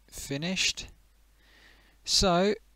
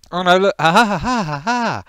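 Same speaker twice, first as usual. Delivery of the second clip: loud high burst of laughter; laughing; 9 semitones higher, 9 dB louder than usual. Real, not acted. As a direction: laugh at the mess on screen. Laughing at own screen mess.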